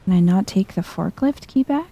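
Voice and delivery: ordinary talk, nothing marked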